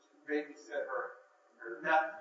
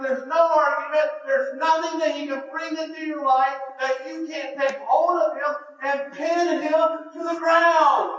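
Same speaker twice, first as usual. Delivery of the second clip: loud, high, driving sermon climax; preaching at full force; 9 semitones higher, 9 dB louder than usual. Acted. Preacher rousing the congregation, loud and fervent.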